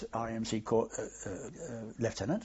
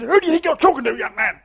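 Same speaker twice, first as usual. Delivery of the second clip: high, loud, outraged rebuke of a junior; indignant shout; 14 semitones higher, 11 dB louder than usual. Retold or imitated. Colonel rounding on an insubordinate captain, loud indignant shout. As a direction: bellow it like an affronted colonel. Colonel retorts in heated row.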